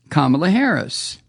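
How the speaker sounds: usual speaking voice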